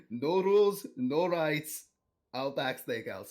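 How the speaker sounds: bad Irish accent that comes out Russian; attempted Irish accent. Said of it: "that was very Russian".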